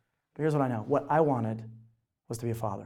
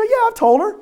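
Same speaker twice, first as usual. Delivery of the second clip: retold reply; 10 dB louder than usual. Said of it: "I go".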